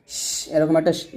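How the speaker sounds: শ- drawn out with breath, slow; airy sh. Shown as a demonstration. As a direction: hiss শ- letting air out. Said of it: "বাতাস বের করবেন". (বাতাস বের করে শ-)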